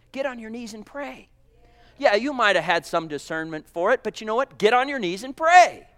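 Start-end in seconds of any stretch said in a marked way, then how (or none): 0.1-1.3 dropped soft, almost to a hush; hushed command. Acted. Quiet then louder repetition. 4.6-5.9 higher, stronger, pressing down on pray; firm command. Acted. Preacher urges judging church members to pray, firm and raised. Louder repeat of same line.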